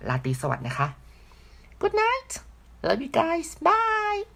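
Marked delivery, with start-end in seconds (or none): 1.8-4.2 tiny falsetto goodbye, drawn out at the end; playful high-pitched sign-off. Real, not acted. Tired vlogger saying goodnight to viewers, playful high-pitched sign-off. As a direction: squeak a very high, sweet farewell.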